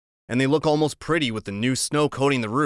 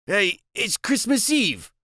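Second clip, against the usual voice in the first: nervous; 9 semitones higher, slower than usual. Acted. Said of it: "he sounds real nervous".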